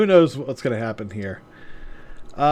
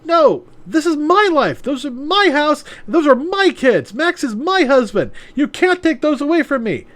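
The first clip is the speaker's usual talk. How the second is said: high, louder tearful protest; crying protest; 12 semitones higher, 4 dB louder than usual. Acted. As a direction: plead through mock tears. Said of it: "Maya starts to cry".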